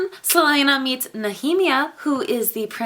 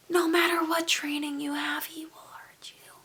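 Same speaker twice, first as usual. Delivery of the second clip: softer ominous whisper; 6 semitones higher, 9 dB quieter than usual. Real, not acted. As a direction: drop to a dark whisper. Ominous warning aside.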